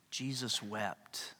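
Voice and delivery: a slow, quiet solemn reading; slow and soft. Acted. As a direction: let each word fall slowly and softly. Reading the shortest verse.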